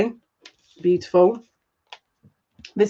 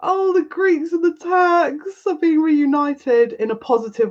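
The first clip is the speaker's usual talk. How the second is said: moved to tears; 9 semitones higher than usual. Real, not acted. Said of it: "I'm gonna cry".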